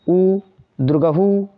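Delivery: teacher stretching उ, दुर्गहु for the class; drawn-out demo sounds. Shown as a demonstration. Teacher to students, drawn-out demo of उ, दुर्गहु. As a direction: slowly sound out उ and दुर्गहु, each vowel held long. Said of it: "इसमें थोड़ा ज़्यादा sound लगाएंगे".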